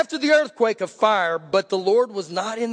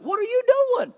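incredulous challenge; 14 semitones higher, faster than usual. Acted. God's challenge voiced by preacher.

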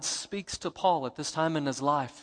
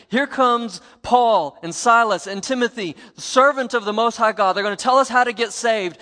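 loud, higher, eager announcement; sincere announcing; 6 semitones higher, 9 dB louder than usual. Retold or imitated. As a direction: herald them in gladly to the crowd. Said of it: "she was not saying".

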